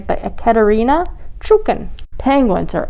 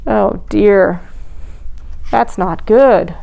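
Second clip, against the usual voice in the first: ordinary delivery, nothing marked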